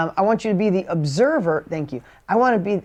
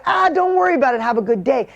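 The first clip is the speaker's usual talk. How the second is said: higher, brighter mock easygoing voice; breezy friendliness; 6 semitones higher than usual. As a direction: toss it off cheerfully to the clerk. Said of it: "yelling at the clerk ... to".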